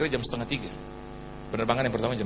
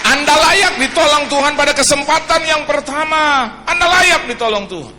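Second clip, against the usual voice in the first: loud preaching, pressing each word; forceful preaching; 9 semitones higher, 10 dB louder than usual. Acted. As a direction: proclaim it to the whole church. (khotbah lantang penuh semangat)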